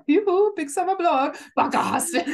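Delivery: high, laughing Scandinavian shopkeeper voice; hammed-up Scandinavian sing-song; Scandinavian accent. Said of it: "It sounds more German".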